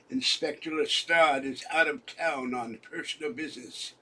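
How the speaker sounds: a lightly held British gentleman voice; lightly voiced British accent, fading toward his own voice; mock British accent. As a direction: play a lightly British official asking for Holmes. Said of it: "my British accent".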